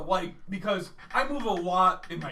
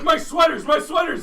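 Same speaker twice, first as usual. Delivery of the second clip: loud, much higher frantic cry; frantic yelling; 9 semitones higher, 8 dB louder than usual. Retold or imitated. Sleepwalker hunting the closet for sweaters, frantic yelling. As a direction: yell in panic for lost sweaters.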